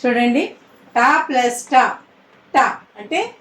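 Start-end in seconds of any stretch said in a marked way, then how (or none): none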